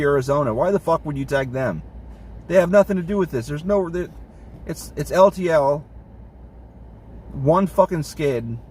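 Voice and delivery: normal talking voice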